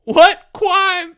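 very high, loud wail; moaned. Acted. Porge protesting as Leo grabs him, high moan. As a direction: moan it in distress. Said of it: "moaned Porge".

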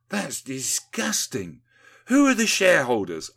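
angry. A narrator's reading. Windy challenging the sewage profiteers, angry. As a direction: draw out the disgust, then demand loudly. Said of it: "asked Windy angrily".

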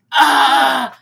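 loud, hammed-up scream; mock scream. Retelling Tommy's anguished scream as a mock yell.

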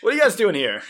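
high, loud, laughing fake alarm; mock surprise. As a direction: pretend to catch someone sneaking in. Mock spotting the listeners.

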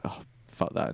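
whispered dismissal. Retold or imitated. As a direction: brush it off under the breath in a whisper. Abigail dismissing her husband.

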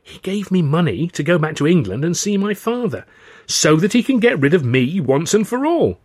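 high, loud accusing outburst; protesting cry. Acted. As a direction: cry out indignantly, pitch swooping up. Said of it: "I cried".

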